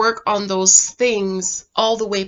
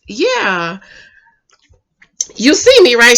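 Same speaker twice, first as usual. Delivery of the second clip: imitated coy reply; 4 dB louder, slower than usual. Retelling her answer to his proposal, mildly coy imitation. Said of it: "And she says".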